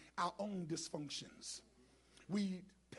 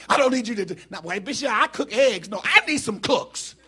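loud, driving pulpit delivery, building to a shout; full-force preaching; 2 semitones higher, 15 dB louder than usual. Real, not acted. Preacher calling for cooks in the congregation, loud and building. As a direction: call out to the whole church, full voice.

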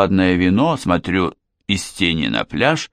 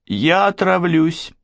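slow, higher, tearful threat; on the verge of tears; 8 semitones higher, slower than usual. A narrator's reading. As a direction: say it slowly, about to burst into tears. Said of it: "заявила она и разревелась".

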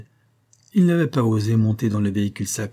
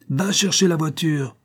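abrupt, higher command; a master cutting off his own rant; sudden shouted order; 6 semitones higher than usual. A narrator's reading. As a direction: snap out the order. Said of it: "cria-t-il tout à coup en changeant de ton".

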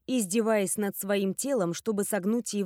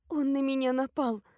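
higher-pitched, half-asleep mumble; sleepy mumble; 5 semitones higher than usual. A narrator's reading. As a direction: mumble it while drifting back under. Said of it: "пробормотала я".

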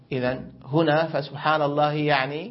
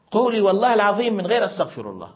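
put-on child's demand; 6 semitones higher, 5 dB louder, faster than usual. Younger sister demanding the oath.

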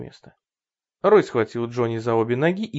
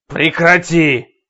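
loud, slow snapping bark; barked order; 4 semitones higher, 10 dB louder, much slower than usual. Acted. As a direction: bark it at her. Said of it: "рявкнул он".

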